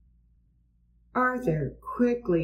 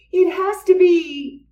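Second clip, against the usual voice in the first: 1 eager, high shout; 11 semitones higher, 5 dB louder than usual. A narrator's reading.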